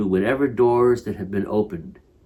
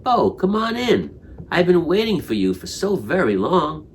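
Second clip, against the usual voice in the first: higher, gentle welcome from within; the heart's spark inviting us in, tender and higher; inviting inner voice; 7 semitones higher than usual. A narrator's reading. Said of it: "that spark will speak to us from within and say".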